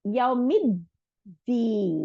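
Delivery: very slow, drawn-out tajweed chant; Quran recitation. Shown as a demonstration. Teacher recites the verse for students, slowly chanted. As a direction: stretch every vowel as in recitation. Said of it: "তাহলে".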